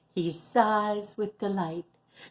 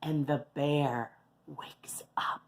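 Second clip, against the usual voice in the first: whispered reveal; 3 semitones lower, 6 dB quieter than usual. A narrator's reading. Telling children the bear wakes, in a whisper. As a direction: breathe it out like a secret.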